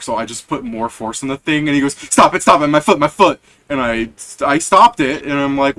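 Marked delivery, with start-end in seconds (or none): 2.0-3.4 louder pleading cry about his foot; louder, pained begging shout; pained yelling. Retold or imitated. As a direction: yell it with a foot caught in the door. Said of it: "started screaming to stop it".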